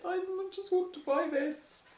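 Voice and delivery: re-enacted high-pitched pleading. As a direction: squeak out a helpless little appeal. Flustered mother pleading with store manager.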